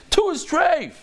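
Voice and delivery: high-pitched, emphatic pronouncement; mock-mad ruling. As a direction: proclaim it high, as if annoyed at being asked. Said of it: "He wouldn't say it like that, but he'd say".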